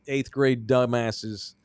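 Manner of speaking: usual speaking voice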